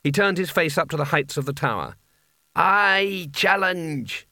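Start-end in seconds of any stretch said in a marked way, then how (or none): 2.5-4.3 higher, hugely drawn-out formal challenge; Abrim. A narrator's reading. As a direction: proclaim it slowly as the possessed sorcerer. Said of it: "he said in the hat's voice".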